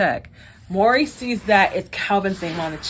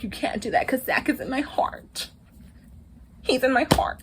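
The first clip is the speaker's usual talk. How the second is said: higher, softer retold voice; lightly acted plea, pitched up and soft; mock heartfelt plea; 5 semitones higher, 6 dB quieter than usual. Woman telling him Zach is in her heart, mock heartfelt plea.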